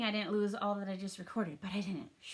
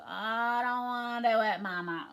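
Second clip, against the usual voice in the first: put-on groaning child; 4 dB louder than usual. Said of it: "And the word groans".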